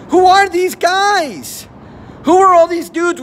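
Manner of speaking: high, slow, drawn-out disbelief; incredulous. Real, not acted. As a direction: stretch every word in disbelief. Incredulous question.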